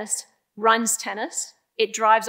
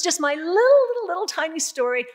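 playful high-pitched emphasis on smallness; playful minimizing; 8 semitones higher, 4 dB louder than usual. Real, not acted. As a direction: squeeze "little" up high to make it tiny. Playing down her own role.

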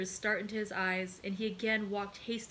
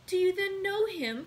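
high, eager exclamation; breathless-with-hope young woman's question; joyful cry; 10 semitones higher, 5 dB louder than usual. A narrator's reading. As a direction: cry out in sudden hope, pitch leaping up. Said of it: "cried Julie".

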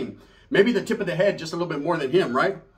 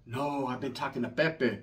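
lower, softer, slow confession; 6 semitones lower, 9 dB quieter than usual. Retold or imitated. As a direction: say it sheepishly. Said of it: "She goes".

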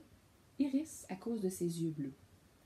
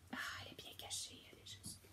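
whisper; 15 dB quieter, much faster than usual. Real, not acted. Parent shows the child the feather, whispering. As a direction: breathe it like a secret. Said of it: "whispering the hiding place".

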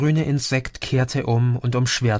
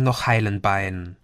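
ordinary delivery

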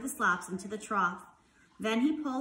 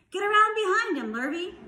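raised, loud call to the farmhand; farmer's orders; 10 semitones higher, 7 dB louder than usual. A narrator's reading. Farmer giving orders in story.

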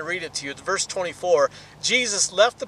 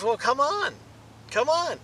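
higher, coaxing come on; inviting call; 7 semitones higher than usual. Acted. Jesus calling Peter out onto the water, coaxing. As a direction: beckon him out onto the water.

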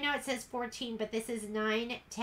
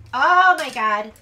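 surprised exclamation; 15 dB louder, slower than usual. Real, not acted. Dog forcing through the door.

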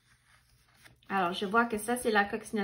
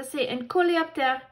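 ordinary delivery, nothing marked

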